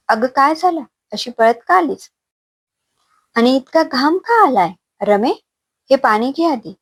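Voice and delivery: worried questions, pitched well up; startled aunt fussing over her niece; alarmed concern. A narrator's reading. Aunt startled to see sweating, frightened Rama, alarmed and high-pitched.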